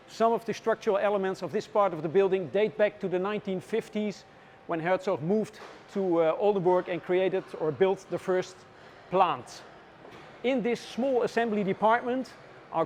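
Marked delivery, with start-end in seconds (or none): none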